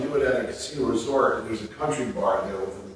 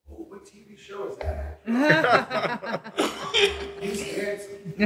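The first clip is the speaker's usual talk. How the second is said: imitating his mother; 10 semitones higher, slower than usual. Son imitates elderly mother's question.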